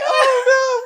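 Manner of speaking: high, loud wail through laughter; overwhelmed happy wail; happy sobbing. Retold or imitated. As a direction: wail it like a big crybaby. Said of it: "sitting there like big old whale".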